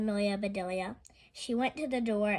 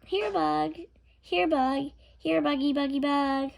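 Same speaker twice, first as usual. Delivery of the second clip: high coaxing sing-song call; calling bugs; 4 semitones higher, 6 dB louder than usual. A narrator's reading. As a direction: coax the bugs like pets. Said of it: "I'll catch".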